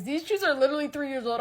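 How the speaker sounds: ordinary talk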